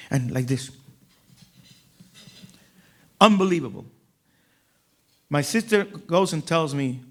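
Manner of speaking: normal talking voice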